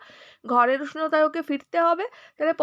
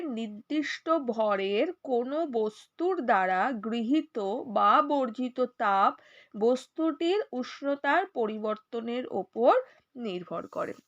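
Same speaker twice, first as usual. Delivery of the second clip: slow dictation; 3 semitones lower, slower than usual. Shown as a demonstration. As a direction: dictate slowly so students can write. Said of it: "লিখে নাও দরকার পড়ে লাইনটা".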